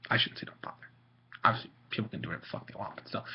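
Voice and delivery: hushed aside to self; whispering. Real, not acted. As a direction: whisper the correction quickly.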